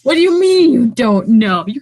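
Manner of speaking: loud, high-pitched mock outrage; exasperated high yell; indignant outburst. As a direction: demand an answer, voice jumping up. Acted quarrel with unhelpful companion.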